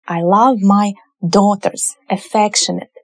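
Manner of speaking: normal talking voice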